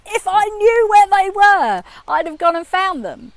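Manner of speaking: apoplectic outburst. Retold or imitated. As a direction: explode with exasperation, dragging it out. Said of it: "sends me into apoplexy of".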